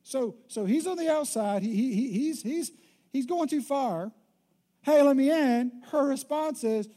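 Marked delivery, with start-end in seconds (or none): 4.8-5.8 louder eager hey; mock call. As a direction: call through the door, hammed up. Husband outside the door.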